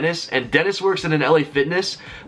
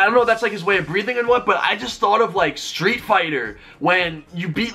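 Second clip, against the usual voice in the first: ordinary talk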